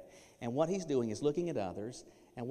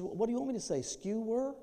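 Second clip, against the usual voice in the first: much higher, exasperated mock wife voice; 11 semitones higher than usual. Husband mimicking his wife's retort about skewer. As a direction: play the wife huffing back at him. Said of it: "she says".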